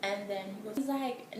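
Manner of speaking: ordinary delivery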